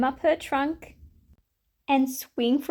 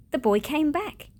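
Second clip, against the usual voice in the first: ordinary delivery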